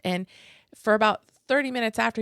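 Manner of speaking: normal talking voice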